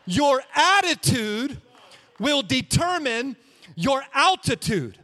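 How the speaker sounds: loud, slow, emphatic preaching; preaching loudly. Shown as a demonstration. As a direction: hammer the line home to the church. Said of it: "I will say that again".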